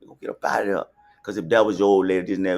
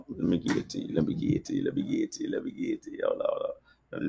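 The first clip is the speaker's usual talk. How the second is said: breathy hushed whisper, teasing the drop; whispered hype before the track; 3 semitones lower, 8 dB quieter, faster than usual. Real, not acted. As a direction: lean in and whisper it low like a secret. Hyping the song he's about to play.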